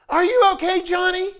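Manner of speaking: mother yelling to her son from the bleachers; mom yells. Retold or imitated. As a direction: call out from the stands, high and anxious. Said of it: "a mom yells from the stands".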